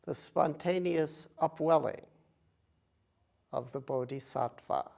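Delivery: measured phrases with long, spacious pauses; unhurried contemplative recitation, phrase by phrase; guided meditation. Acted. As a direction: guide them gently, leaving silence between phrases. Leads a meditation.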